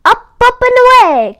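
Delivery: high, loud takeoff call. A narrator's reading. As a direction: call it out as the sleigh lifts off. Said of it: "called Santa".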